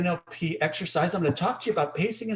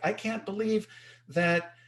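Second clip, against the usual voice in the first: raised-pitch sympathetic outrage; put-on exasperated complaint, higher voice; mock indignation; 7 semitones higher than usual. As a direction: act angry along with them. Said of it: "you're angry about that as well".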